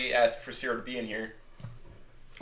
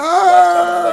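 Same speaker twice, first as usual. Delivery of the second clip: sudden loud outburst; 18 dB louder than usual. Real, not acted. Bursts out loudly.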